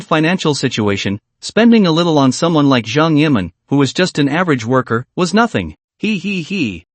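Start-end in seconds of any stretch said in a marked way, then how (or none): 6.0-6.8 high, soft, sly giggle; mischievous giggle. A narrator's reading. Planning to spend his money, a mischievous soft giggle. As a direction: giggle like you're plotting something. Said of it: "Jiang Yimin grinned mischievously".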